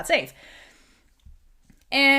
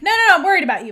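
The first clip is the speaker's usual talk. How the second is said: emphatic protest; 8 semitones higher, 8 dB louder, much faster than usual. Retold or imitated. Alder voiced protesting to Anacostia.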